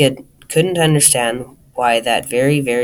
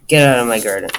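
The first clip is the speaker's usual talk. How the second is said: louder, mildly angry narrated command; cross order; 6 dB louder, much faster than usual. A narrator's reading. Mister Grumpy orders Mister Happy out, cross. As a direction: order him off with a touch of temper.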